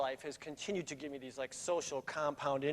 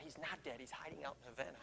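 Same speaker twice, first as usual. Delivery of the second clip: hushed aside; 14 dB quieter than usual. Retold or imitated. Joking thought about Hemingway lookalike.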